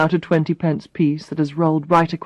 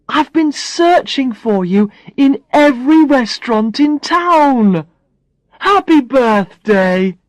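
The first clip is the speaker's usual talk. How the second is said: put-on higher, louder happy boyfriend; excited greeting; 6 semitones higher, 4 dB louder, slower than usual. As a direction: beam it out, slowing on happy birthday. Barry greets her excitedly.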